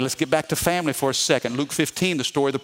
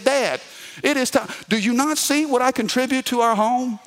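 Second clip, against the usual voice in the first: put-on high, whiny kid pestering his dad; pleading kid; 7 semitones higher than usual. Son demanding an allowance from his dad, high and whiny. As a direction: beg like a put-upon middle schooler.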